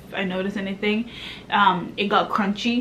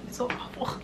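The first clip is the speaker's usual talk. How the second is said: whispered aside, much softer; 3 semitones higher, 13 dB quieter, faster than usual. Real, not acted. Aside to viewers, an unvoiced whisper. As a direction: breathe it with no voice.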